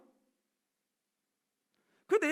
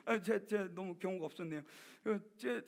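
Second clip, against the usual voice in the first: sheepish apology; 7 semitones lower, 7 dB quieter than usual. Retold or imitated. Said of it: "막 이렇게 따졌단 말이에요".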